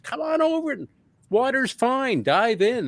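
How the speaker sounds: high-pitched, sing-out invitation; beckoning call. Retold or imitated. Somebody urging a newcomer into science fiction, high beckoning call. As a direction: imitate someone calling a friend into the water.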